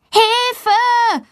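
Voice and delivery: very high cry for help; calling for help. A narrator's reading. Girl stuck on the door calls for help, very high. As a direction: call out with all she has. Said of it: "ruft Valentina so laut sie kann".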